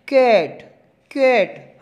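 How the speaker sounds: कैट said with effort on the vowel; stressed vowel. Shown as a demonstration. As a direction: put force on the a of कैट. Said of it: "थोड़ा जोर लगाना पड़ता है".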